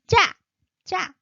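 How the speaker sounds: 짜 said tense and high; teacher shows the tense 짜 on a high pitch. Shown as a demonstration. Teacher models 짜 high and tense. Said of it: "high pitch".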